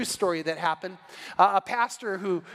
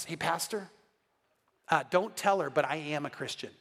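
soft, low imitated secret; confiding aside; 5 semitones lower, 5 dB quieter than usual. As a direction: lean in and confide it quietly. Husband confides secretly behind wife's back.

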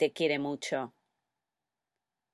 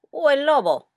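mock suspense; 8 semitones higher, 6 dB louder than usual. Story suspense about the wolf.

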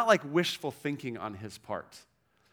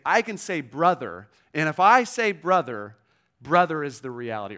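louder, firm "I can say brother"; authoritative declaration; 8 dB louder than usual. Retold or imitated. Risen Jesus claiming his followers as brothers, loud and firm. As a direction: pronounce it with full authority.

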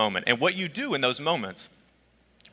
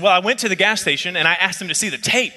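retold anger; 6 semitones higher, 3 dB louder than usual. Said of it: "I mean, she was angry".

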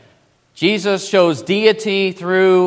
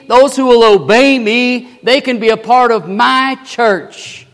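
loud, raised preaching as Jesus; forceful proclamation; 5 semitones higher, 6 dB louder than usual. Acted. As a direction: thunder it out to the congregation.

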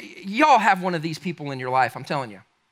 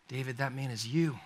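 soft confrontation; 4 semitones lower, 11 dB quieter than usual. Retold or imitated. Nathan confronts David, after pausing.

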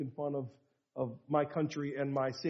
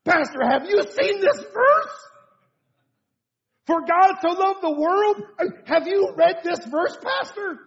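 high, slow, laughing wonder at a new-found verse; giddy new believer; 13 semitones higher, 9 dB louder, slower than usual. Retold or imitated. New believer to his pastor: high, slow, giggly amazement. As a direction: play the thrilled beginner showing his pastor a verse, laughing. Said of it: "I love seeing a new, a new believer just get saved".

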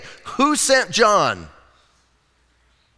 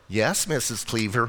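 lightly voiced obedient TV kid, lower and softer; a hint of a polite sitcom boy, pitched down; Wally; 7 semitones lower, 7 dB quieter than usual. Preacher recalling an old sitcom character, lightly imitated, low. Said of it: "Remember Wall-E?".

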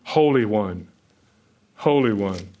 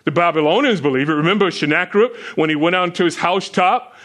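fervent preaching; 4 semitones higher than usual. Acted. Preaching build on arrogant kings.

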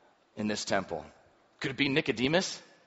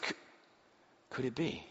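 ordinary talk

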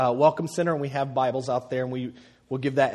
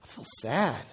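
a soft, lightly voiced sad little boy; imitating sad son; 8 dB quieter than usual. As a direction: mimic his son's mild sadness, softer. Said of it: "he said".

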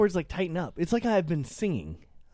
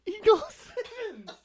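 laughing; 4 dB quieter, much slower than usual. Real, not acted. Laughing at the misheard name.

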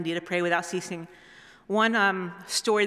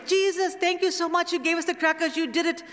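put-on high-pitched gushing childlike thanks; imitated excited kids; 9 semitones higher than usual. Kids thanking Jesus for crackers, imitated excited voices. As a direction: gush like overjoyed children, pitched way up.

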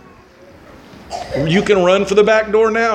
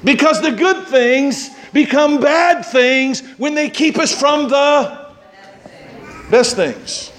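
preaching at full force; 8 semitones higher than usual. Acted. Preacher pressing the congregation, raised and fervent.

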